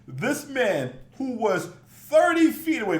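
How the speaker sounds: ordinary talk, nothing marked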